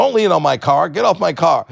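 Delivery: upset comics. Retold or imitated. Comics to kid by their cars, mock upset. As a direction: shoo someone away, imitating irritation. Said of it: "comics would get upset with me".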